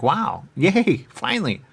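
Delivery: high, loud "wow, yay"; relieved cheer. Retold or imitated. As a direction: burst out with relief.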